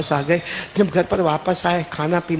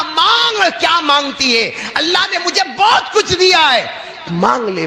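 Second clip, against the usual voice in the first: husband, high and loud, lovingly urging her to ask; overflowing with love, high and loud; loving, excited offer; 9 semitones higher, 3 dB louder than usual. Acted. Husband lovingly tells wife to ask anything, high and loud. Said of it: "اتنے پیار سے کہا".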